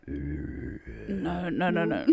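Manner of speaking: very low hollow creature growl; deep monster noise. Retold or imitated. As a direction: drop way down into a cartoon monster voice. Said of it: "You just wanted to make a monster noise".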